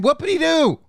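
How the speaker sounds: mocking high whoopity-doo with a falling finish; capping a rant with sarcastic dismissal. Real, not acted. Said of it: "end a rant against someone with whoopity-doo".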